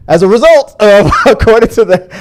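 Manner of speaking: giggling through words; laughing. Real, not acted. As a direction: laugh while talking. Laughing after joke.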